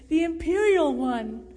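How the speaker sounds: loud, delighted exclamation; jovial cry. A narrator's reading. Jordan greeting an arriving young man, jovial cry. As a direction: cry it out in joyful recognition. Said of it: "he cried jovially".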